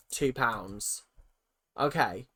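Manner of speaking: usual speaking voice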